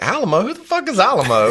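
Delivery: high-pitched incredulous question; baffled retold reaction. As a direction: act out total bafflement, pitch jumping up. Retelling his baffled reaction.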